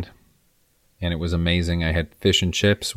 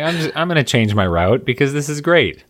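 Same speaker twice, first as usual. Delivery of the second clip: higher-pitched, delighted resolve; excited self-quote; 7 semitones higher, 4 dB louder than usual. Retold or imitated. Tourist deciding to follow singing schoolkids, excited. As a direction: retell your own thrilled snap decision. Retelling own delighted decision.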